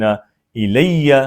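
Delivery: الیہ very slow, doubled ya held; slow demo of ilayya. Shown as a demonstration. Teacher drills الیہ slowly for the class. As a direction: stretch الیہ, lean on the doubled ya. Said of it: "دو یا اکٹھے ہوں گے تو شد".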